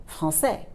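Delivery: français as "frahn-SEH" with open E; wrongly voiced final E. Shown as a demonstration. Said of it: "not français".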